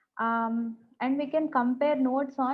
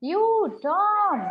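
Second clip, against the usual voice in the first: raised, long-distance call; drawn-out shout; 7 semitones higher, 4 dB louder than usual. A narrator's reading. Aunt calling for Tom across the garden, shouting. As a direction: call out high and loud, stretching each word.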